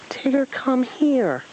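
high, drawn-out summons; calling out. A narrator's reading. The stranger summons Tigger from the forest, calling out. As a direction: slowly call someone back from afar. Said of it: "the stranger yelled off in the direction".

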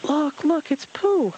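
high thrilled joey cry; excited cry. Acted. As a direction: call out in delight at seeing Pooh. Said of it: "Roo cried".

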